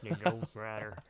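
put-on low western old-timer voice; Sam Elliott. Echoing co-host as the old dog, low Sam Elliott impression. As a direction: drop it low like a seasoned cowboy. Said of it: "Sam Elliott voice".

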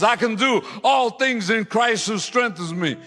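high, loud preached proclamation. Acted. Preacher booms the old woman's verse to the congregation, loud. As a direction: shout the verse to the church.

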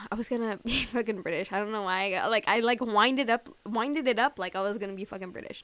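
usual speaking voice

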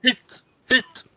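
high, loud repeated shout; insistent shouting. Acted. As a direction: jab each word out urgently. Player shouts pointing at map. (ropar ivrigt och högt)

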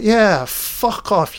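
yeah drawn out high and slow, fuck off; hammed-up high jeer; high mock taunt. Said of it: "Freddy's like".

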